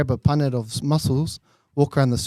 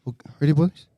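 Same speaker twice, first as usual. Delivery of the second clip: usual speaking voice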